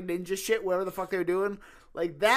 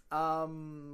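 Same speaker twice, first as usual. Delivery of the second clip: ordinary talk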